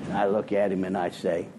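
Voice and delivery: usual speaking voice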